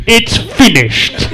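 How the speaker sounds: put-on slow, heavy Eastern European accent, loud and flat. Mechanic calling about the car, thick Eastern European accent. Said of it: "the thickest Eastern European accent ever".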